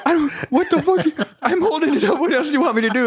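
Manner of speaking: high-pitched, flustered mock recruit; imitated bewildered recruit with laughter in it; confused recruit. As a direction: protest in a high voice, baffled. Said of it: "he's confused".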